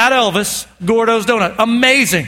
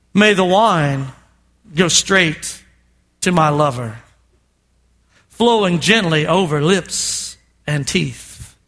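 tender scripture reading; 7 semitones lower, slower than usual. Acted. Pastor reads the bride's reply from Song of Songs, low and tender. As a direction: read it like a love poem.

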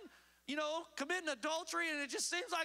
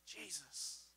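whispered name; 10 semitones lower, 11 dB quieter than usual. Acted. Mary breaks down to Jesus.

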